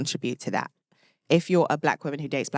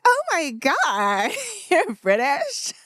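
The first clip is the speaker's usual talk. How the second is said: high, slow, drawn-out American squeal; mock an American fawning over an accent; hammed-up American delight, laughing; gushing American impression; 13 semitones higher, much slower than usual. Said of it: "They're like".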